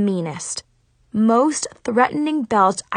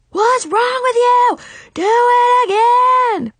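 furious shout; 7 dB louder, slower than usual. Acted. Sensei screaming at student.

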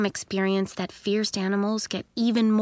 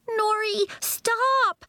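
put-on much higher pleading girl's voice; begging; 12 semitones higher, much slower than usual. A narrator's reading. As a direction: plead with your friend to stop. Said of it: "Pepper begged".

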